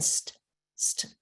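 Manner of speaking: unvoiced st- repeated; voiceless "st-" tapped twice; whispered blend sounds. Shown as a demonstration. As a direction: breathe out "st-, st-" with no voice. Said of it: "your tongue is tapping".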